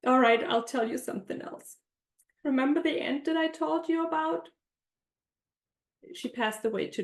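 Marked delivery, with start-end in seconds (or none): none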